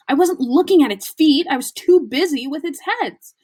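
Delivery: high, loud exasperation; indignant protest. Acted. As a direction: protest it heatedly. Ron protesting.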